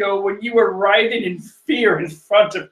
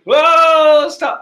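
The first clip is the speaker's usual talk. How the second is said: loud, high mock yell of whoa; mock shout; 9 semitones higher, 7 dB louder than usual. Brook startled into yelling stop, a loud, high mock shout.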